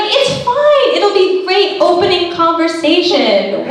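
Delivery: a hint of high bright mom reassurance; imitating mom. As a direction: play mom brushing off worries.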